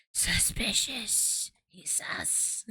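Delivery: slow, hushed, drawn-out suspicious; mock suspicion. Real, not acted. Streamer teases the character's name, slow hushed suspicion. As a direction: draw it out slowly like a conspiracy.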